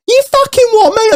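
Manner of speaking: very high-pitched mock outrage; shrill retold challenge; outraged comeback, imitated. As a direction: imitate an affronted woman squaring up. Woman's comeback in retold row.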